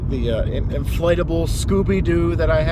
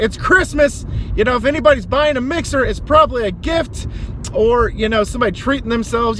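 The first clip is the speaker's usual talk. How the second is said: exasperated rant; 8 semitones higher, 4 dB louder than usual. Retold or imitated. Ranting at the seller.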